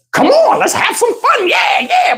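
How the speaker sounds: put-on high, excited party-girl voice; imitating Tony. Comedian mimics Tony's eager call, high and excited. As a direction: bounce it up like she can't wait.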